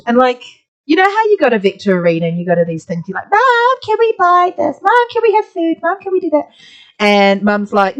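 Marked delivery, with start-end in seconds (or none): 3.3-6.5 put-on high-pitched pleading child; begging kids. Kids nagging mum at the arena to buy things, high pleading.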